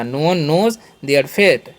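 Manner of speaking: very slow, word by word; slow classroom dictation; slow example sentence. Shown as a demonstration. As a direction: spell it out slowly for the class. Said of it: "ऐसे बोल सकते हैं".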